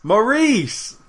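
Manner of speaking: high, loud sudden recall; excited shout. Real, not acted. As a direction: blurt the name out high. Echoing the recalled name.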